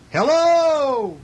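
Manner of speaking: high, loud storybook call; shouted hello. A narrator's reading. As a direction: holler up to the moon, drawing out the word. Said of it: "again he shouted".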